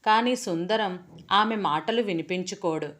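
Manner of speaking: plain reading voice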